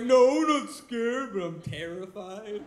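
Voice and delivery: loud, slow, panicky denial; mock panic. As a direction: blurt it out in mock terror.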